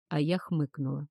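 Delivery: ordinary delivery, nothing marked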